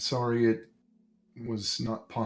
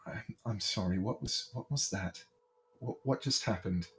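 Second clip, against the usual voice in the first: softer, bewildered murmur; a lightly voiced baron, lost and quiet; confused baron; 10 dB quieter than usual. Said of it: "he looks very confused and he says".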